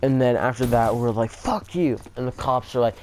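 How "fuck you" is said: mock angry retort. Kids answering the smiling scooter thief, mock angry. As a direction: imitate an indignant comeback, pitched up. Retold angry reply to taunt.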